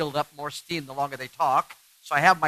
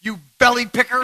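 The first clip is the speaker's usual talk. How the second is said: high, louder mock insult; 6 semitones higher, 5 dB louder than usual. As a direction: fling a silly name. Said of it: "she says".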